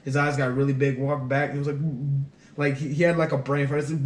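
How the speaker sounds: ordinary talk, nothing marked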